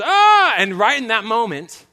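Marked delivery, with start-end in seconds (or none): 0.0-0.5 retold scream. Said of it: "she's just".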